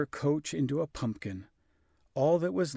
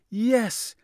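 high, loud happy yes; joyful cry; 11 semitones higher, 7 dB louder than usual. A narrator's reading. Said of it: "cried Cinderella".

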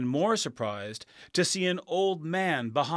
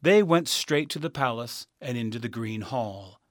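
plain reading voice

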